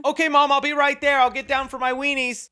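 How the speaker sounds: put-on high, loud kid answering back; son calling to Mom. Acted son shouts back to mom.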